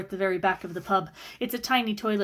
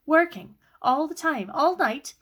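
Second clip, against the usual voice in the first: higher, louder, incredulous; indignant echo; 7 semitones higher, 4 dB louder, slower than usual. Acted. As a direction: throw his word back at him. Sister throws his word back.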